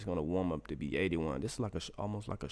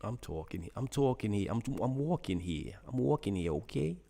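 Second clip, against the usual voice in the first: mock-indignant New York accent, rising higher; imitated New York talk; 3 semitones higher than usual. Teasing his wife's New York talk, exaggerated accent. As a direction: play the put-out New Yorker. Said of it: "I laugh at the New York, um, uh, talk".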